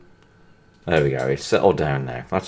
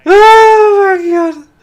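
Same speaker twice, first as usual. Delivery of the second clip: high and loud, gasping from laughing; catching breath after a fit of laughter; crying with laughter; 12 semitones higher, 6 dB louder, much slower than usual. Real, not acted. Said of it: "I'm crying here".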